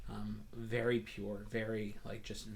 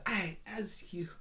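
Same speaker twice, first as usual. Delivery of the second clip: higher, bright-pitched welcoming shop cat; Moon the Tabaxi shopkeeper; 3 semitones higher than usual. Acted. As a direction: greet customers grandly in a high voice. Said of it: "she turns around and goes".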